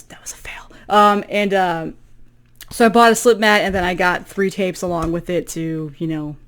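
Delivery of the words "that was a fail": whispered aside; quiet whisper to self. Real, not acted. Fumbled slip mat demo aside.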